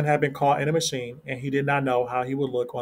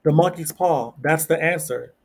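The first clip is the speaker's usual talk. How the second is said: higher, louder excited outburst; sudden realization; 4 semitones higher, 4 dB louder than usual. Acted. Wife waking her husband at night with a sudden idea, higher and louder. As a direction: blurt it out as the idea hits. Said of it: "woke up and screamed".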